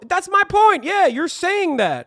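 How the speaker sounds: high, loud indignant insistence; squawking, worked-up objection; exasperated protest. Real, not acted. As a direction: push the voice way up in exasperation. Exasperated at the clip's contradiction.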